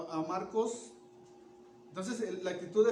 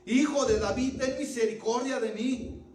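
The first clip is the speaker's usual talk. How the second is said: loud, higher plea, pushed harder; crying out louder; 5 semitones higher, 6 dB louder than usual. Acted. Bartimeo calls out louder despite the crowd, a loud plea. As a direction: cry out even louder for mercy. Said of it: "Clamaba mucho más".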